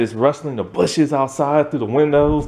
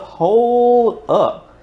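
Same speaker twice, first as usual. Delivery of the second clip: surprised; 9 semitones higher than usual. Retold or imitated. Stunned by the plot twist.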